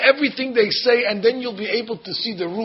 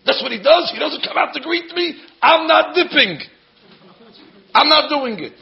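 indignant refusal; 7 semitones higher than usual. Retold or imitated. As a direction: refuse like a wounded general. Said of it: "And Naaman says".

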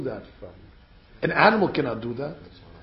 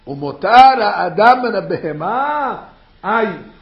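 loud proclaiming; 2 semitones higher, 10 dB louder, slower than usual. Real, not acted. Preacher quoting the verse loudly.

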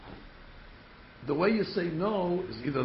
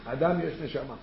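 ordinary talk, nothing marked

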